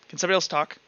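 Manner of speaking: usual speaking voice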